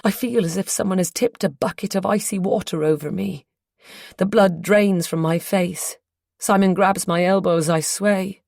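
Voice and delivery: usual speaking voice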